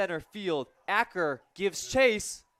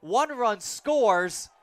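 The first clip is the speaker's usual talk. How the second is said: excited play-by-play; 4 semitones higher, 5 dB louder than usual. Real, not acted. Run scores, announcer lifts.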